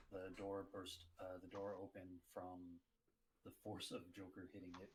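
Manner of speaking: usual speaking voice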